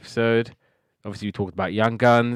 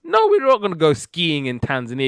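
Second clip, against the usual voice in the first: raised-pitch imitation of an exasperated dad; exasperated refusal; 6 semitones higher, 4 dB louder than usual. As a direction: shoot the silly idea down, pitched up. Father rejects kids' silly request.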